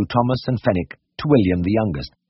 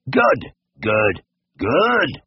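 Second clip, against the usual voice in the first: each good deeply satisfied, the last one higher; deep satisfaction; 4 semitones higher, much slower than usual. A narrator's reading. Brothers echo William with deep satisfaction. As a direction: savour every good. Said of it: "with deep satisfaction".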